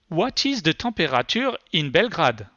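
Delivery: English with a heavy French accent, voice query; French-accented English, rolled French r in temperature; thick French accent. Shown as a demonstration. Presenter asks the voice search, in thick French accent. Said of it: "speak English with my thick French accent".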